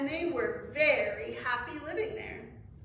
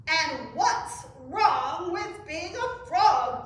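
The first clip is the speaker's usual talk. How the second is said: loud, slow, higher retort; indignant exclamation; 4 semitones higher, 8 dB louder, slower than usual. A narrator's reading. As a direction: challenge them as offended frogs. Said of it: "exclaimed the frogs".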